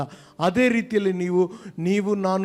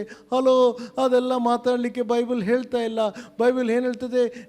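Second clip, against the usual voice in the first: higher, insistent preaching; 5 semitones higher than usual. Acted. Preacher rebuking idle talk, higher and insistent. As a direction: pull them back to the point.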